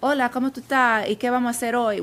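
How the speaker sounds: put-on light Dominican Spanish accent. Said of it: "With, like, a Dominican accent".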